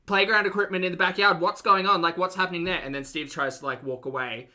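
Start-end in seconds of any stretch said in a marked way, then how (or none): 0.0-2.8 higher, louder mock interrogation; insistent doorstep-reporter voice; confronting TV reporter. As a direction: press for answers like an ambushing journalist. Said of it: "They're like".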